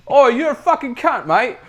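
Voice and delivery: put-on loud, high English accent caricature; hammed-up English accent at full volume; exaggerated Englishman. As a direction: yell it like a rough English bloke. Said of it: "sounds like an actual Englishman".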